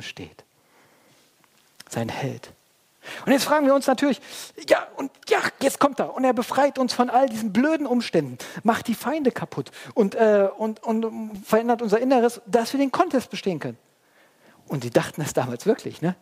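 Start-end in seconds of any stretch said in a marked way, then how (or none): 3.2-13.8 high, loud, eager build-up; high, loud excited sermon voice; excited preaching. Acted.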